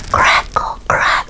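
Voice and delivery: breathy whispered crackle noise. Acted. Storyteller whispers the creaking noise for children. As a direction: make the creepy sound in the dark. Imitated creepy sound.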